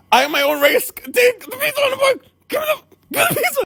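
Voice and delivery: high, fast frantic repeating; mock desperate plea. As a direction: beg for the pizza hammed up.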